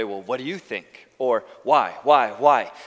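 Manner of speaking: put-on arrogance, dropped in pitch; mock arrogant. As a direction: imitate a haughty questioner, pressing harder on each why. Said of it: "did it in an arrogant way".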